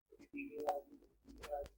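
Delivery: whispered echo of the phrase; fading whisper. Acted. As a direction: let it fade to breath.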